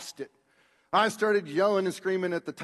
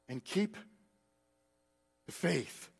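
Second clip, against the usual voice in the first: ordinary delivery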